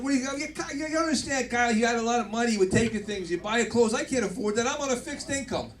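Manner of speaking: a hint of a whining father; mock dad complaint. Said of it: "I'm like".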